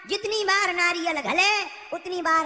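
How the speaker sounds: ordinary talk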